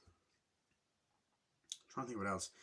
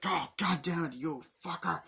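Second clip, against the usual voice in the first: raised, louder mock outrage; acted angry cursing; 6 semitones higher, 4 dB louder than usual. Priest forced to open the safe, angry cursing. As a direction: curse him while giving in, hammed up.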